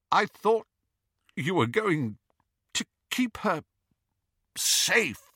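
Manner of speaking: high, broken, halting grief; anguished accusation. Acted. Grieving Snape accusing Dumbledore, high broken anguish. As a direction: choke out the betrayal in pieces.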